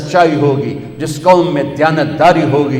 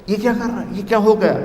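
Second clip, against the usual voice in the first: higher, softer astonished question; amazed mother; 6 semitones higher, 4 dB quieter, much faster than usual. Retold or imitated. Mother seeing the baby, softly asking in high amazement. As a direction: ask in hushed wonder like the mother.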